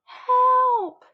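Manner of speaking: high, loud panicked call; yell for help. A narrator's reading. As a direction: cry out for rescue. Child cries for help.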